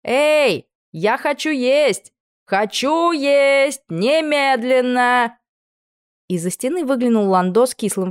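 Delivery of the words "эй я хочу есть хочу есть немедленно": high, long drawn-out calls; hungry yelling. A narrator's reading. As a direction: stretch each demand out like a spoiled captive hollering. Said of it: "вопя во всё горло".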